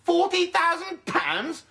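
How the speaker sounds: high, slow, incredulous echo of Forty; outraged disbelief. Acted. Alan echoing the ransom demand in high, slow disbelief. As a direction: drag out the sum in shock, pitched high.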